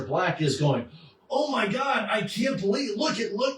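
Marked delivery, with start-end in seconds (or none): 1.3-3.6 high, shouted mock panic; panicked villain. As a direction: blurt it in horror.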